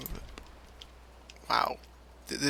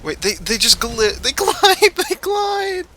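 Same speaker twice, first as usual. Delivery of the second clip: startled; 5 dB louder than usual. Real, not acted. Player startled by gliding enemies.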